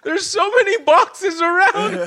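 very high, squeaky comic protest; high-pitched pretend hurt, loud and aggrieved; mock whining child. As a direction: plead in a hammed-up whine, half laughing. Plays wronged child complaining to mom.

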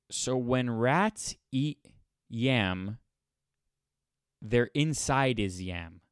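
slow, careful translation; slow decoding. Shown as a demonstration. Decoding the proverb for listeners, slow and careful. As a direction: spell out the meaning slowly. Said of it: "decode this for you".

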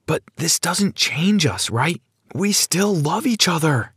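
high-pitched, tentative plea; pleading. Acted. Brad begs Sarah not to change, pleading. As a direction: plead gently, seeking reassurance.

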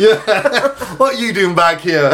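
high, laughing attempt at English accent; badly faked English accent through giggles. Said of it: "tried to do an English accent".

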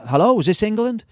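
high-pitched put-on caller voice; imitated phone caller. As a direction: pitch it up as a stranger phoning from abroad. Voicing American caller on phone.

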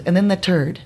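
the turd, TH said as T, through a laugh; joke: third said as turd; Irish T for TH. Retold or imitated. Tom Keith's pun, third said turd. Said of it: "And he goes".

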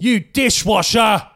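very high, slow mock shout; hammed-up enraged Englishman, at full volume; angry English yell. Shown as a demonstration. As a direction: stretch the noun into a furious insult. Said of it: "English accent can yell any noun at somebody".

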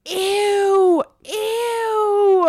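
high, loud, drawn-out squeal; mock disgusted crowd. Mimicking everyone in the cinema, grossed-out squeal. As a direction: imitate an audience squealing in disgust. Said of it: "everyone in the mov".

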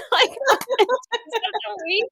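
high, breaking up with laughter; laughing. Real, not acted. Friend recalling homemade uniforms, laughing. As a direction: laugh helplessly through the words, slowly. Cracking up at her own story.

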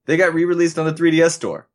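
fast, high eager reply; excited. Acted. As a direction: rush the good news out. Acted phone call, eager reply.

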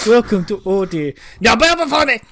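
booming, pompous villain welcome; mock tyrant announcement. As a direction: roar it like a ham despot.

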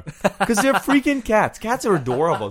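host explaining cat videos, gushing. Real, not acted. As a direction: melt over cats. Gushing over cats.

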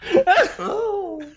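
gasp. Real, not acted. Reacting to the slap.

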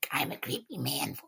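whispery creep; hushed, half-whispered creepy man. Acted. Showing the voice they sometimes have to do, creepy whisper.

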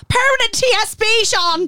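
high-pitched yell over club noise; retold drunken phone howl. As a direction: holler it into the phone, voice pitched right up. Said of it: "she was howling down the thing".

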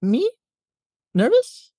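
high, loud disbelieving rise on Nervous; mock surprise. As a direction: act startled at being called nervous. Astronaut reacts, pitch jumps.

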